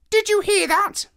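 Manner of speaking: high, loud squawking parrot. Acted. Parrot answering the president who betrayed him, high squawking voice. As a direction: squawk it out, cold and bitter.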